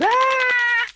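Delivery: high, loud mock scream; shrill-pitched imitation of a film scream; movie scream. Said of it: "screaming movies where the woman goes".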